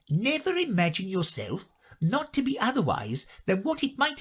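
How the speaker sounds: high, prim storybook duchess. A narrator's reading. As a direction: lecture it in the Duchess's voice. Duchess voiced at length.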